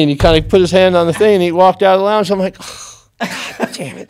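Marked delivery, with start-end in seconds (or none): 2.6-4.1 slow whispered self-rebuke; slow whispered groan at himself; whispered curse. Retold or imitated. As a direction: breathe it out, slowly, to yourself. Cursing himself under his breath.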